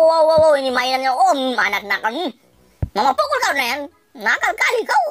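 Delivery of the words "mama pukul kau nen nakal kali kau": high, mock-stern mom telling off; scolding mother. Game mom scolding her baby for scattered clothes, mock-stern and high. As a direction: lightly play the cross mum.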